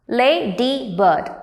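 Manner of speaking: slow "Ladybird" as la, dy, bird; three separate beats. Shown as a demonstration. Teacher counting three beats, "Ladybird" slow and split. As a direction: break "Ladybird" into its syllables. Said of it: "Three beats are there".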